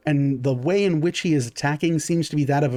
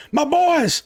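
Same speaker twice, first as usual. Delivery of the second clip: high-pitched, drawn-out cry of dismay; calling out; 12 semitones higher than usual. Acted. Game master as enemy leader seeing his men fall, anguished call. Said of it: "their leader kind of call out and go".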